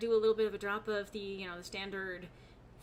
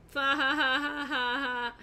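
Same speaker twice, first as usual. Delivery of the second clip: text-to-speech voice; 4 semitones higher, 7 dB louder than usual. Shown as a demonstration. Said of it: "I read that like a fucking, auto text".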